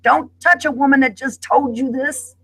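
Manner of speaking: high, sharp mock scream; wife recalling screaming at her husband, high and sharp; retold scream. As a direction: throw it back at him like you did then. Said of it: "I screamed at him and said".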